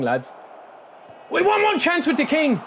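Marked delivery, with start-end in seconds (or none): 1.3-2.6 pitched way up and louder, urging; excited high plea. Real, not acted. Gamer begging his striker for one chance, excited high plea. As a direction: cry it out hoping for one chance.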